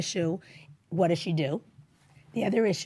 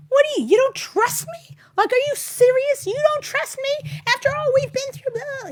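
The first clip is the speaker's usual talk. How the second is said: high, loud indignant protest; defensive outrage; 6 dB louder than usual. Retold or imitated. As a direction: squeal in offended disbelief. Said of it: "you would be defensive also".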